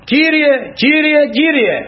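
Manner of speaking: high, slow, drawn-out invocation; acted pleading prayer cry; calling on the Lord. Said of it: "φωνάζω".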